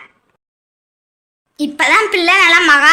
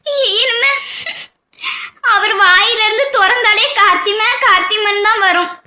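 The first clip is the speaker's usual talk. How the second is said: sobbing between broken phrases; crying. Acted. Mother to relatives as her daughter marries, crying. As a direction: talk through tears, catching the breath. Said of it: "நீங்க அழாதீங்க அக்கா".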